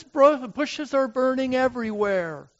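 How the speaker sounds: urgent wonder at the burning bushes; excited cry. Acted. First cry about the bushes.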